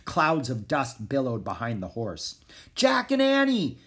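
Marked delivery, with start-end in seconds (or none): 2.8-3.8 high, ringing call; crying out. A narrator's reading. Prince hailing two friends from horseback, high loud cry. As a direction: shout their names from afar. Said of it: "Alexander cried".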